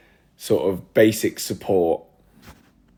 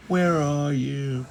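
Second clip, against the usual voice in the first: high, slow singsong call; high-pitched, stretched-out search call; 7 semitones higher, much slower than usual. Real, not acted. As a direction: sing it out high while hunting for something. Searching for the Muppets list.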